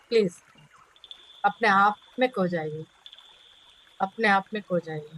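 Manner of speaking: measured meditation voice, long pauses between phrases; guided meditation. Acted. Teacher leading students into meditation for themselves, guided meditation voice. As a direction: settle them inward, one phrase at a time.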